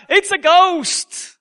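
high, loud fearful cry of the disciples; frightened cry. Acted. Preacher voicing terrified disciples in the storm, frightened cry.

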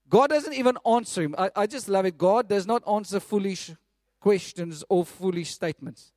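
ordinary delivery

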